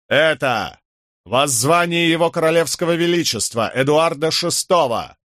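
loud, high royal proclamation; booming proclamation. A narrator's reading. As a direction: announce it like a herald. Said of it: "громогласно изрёк он". (громогласное объявление)

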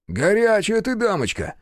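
high-pitched playful admiration; admiring exclamation. A narrator's reading. Бучило teasing a lady over the blaze, admiring.

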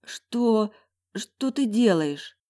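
high, slow, stunned question; stunned disbelief. A narrator's reading. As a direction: ask in breathless shock. Stunned heroine asks.

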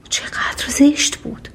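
hushed, slow whisper of dismay; a slow, hushed aside. A narrator's reading. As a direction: breathe it out, barely voiced. Narrator whispers Beauty's fright.

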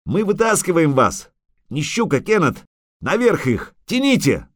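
high, urgent calling; excited, high-pitched commands; shout from above. A narrator's reading. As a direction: call out hauling orders. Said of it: "крикнул сверху Лютик".

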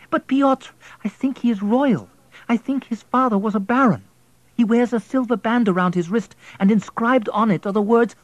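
put-on a wife pleading for the boy.

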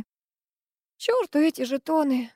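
slow listless reply; sluggish. A narrator's reading. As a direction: drag it out, no energy. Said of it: "вяло ответила я".

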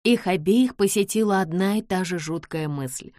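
usual speaking voice

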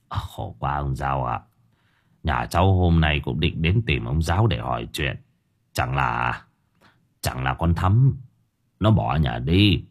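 an anxious father breaking bad news, low; old vendor voice. A narrator's reading.

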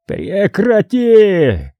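very slow, high howled word; wailing howl. Acted. Cardinal howls at the hero to stop asking, slow high howl. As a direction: stretch it into a wolfish howl. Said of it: "завопил он, взвыв по-волчьи".

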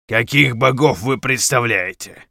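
loud, slow, otherworldly shaman; stern shaman voice. Acted. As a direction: question them like an ancient shaman. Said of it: "спрашивает незнакомец".

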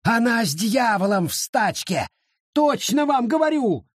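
climbing to a high cry; shrill insistence. A narrator's reading. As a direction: screech it at them. Wallace shrieking on.

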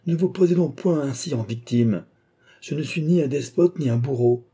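high-pitched, irritated protest; exasperated outcry. A narrator's reading. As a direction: cry out as if stamping a foot. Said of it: "s'écria le chef de bureau en frappant".

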